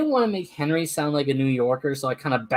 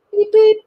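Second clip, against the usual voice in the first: cheerful engine beep; 10 dB louder than usual. Acted. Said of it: "said Edward".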